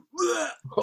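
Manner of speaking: high, sudden puking noise; imitated retching. Storyteller imitating puking out the car window, high retch. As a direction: mimic throwing up. Buckets of puke.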